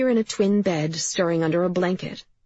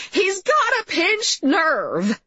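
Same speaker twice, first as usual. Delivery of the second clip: high, drawn-out wailing mother voice; interfering mother; 13 semitones higher, 4 dB louder, much slower than usual. A narrator's reading. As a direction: butt in from the doorway, high and slow.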